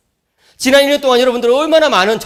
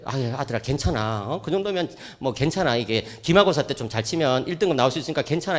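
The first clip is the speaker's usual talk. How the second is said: soft, lower, soothing father; comforting dad; 5 semitones lower, 7 dB quieter than usual. Retold or imitated. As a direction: gently console the upset son. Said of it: "이렇게 위로를 했더니". (아들을 달래는 부드러운 아빠 목소리)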